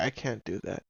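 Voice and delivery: ordinary delivery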